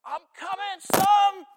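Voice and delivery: very high, full-voiced shout; father calling back. Father running to his son's cry, high urgent shout. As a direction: yell it as you rush to your child.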